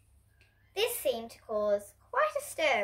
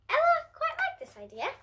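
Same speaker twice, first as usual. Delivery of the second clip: much higher, squeaky delighted tone. Acted. Narrator sharing Ella's delight, high and squeaky. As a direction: lift way up like a tickled child.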